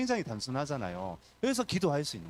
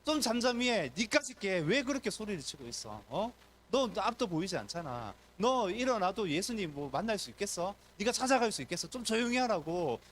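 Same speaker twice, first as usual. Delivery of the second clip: scolding crowd; 6 semitones higher than usual. Acted. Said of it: "꾸짖기 시작하는".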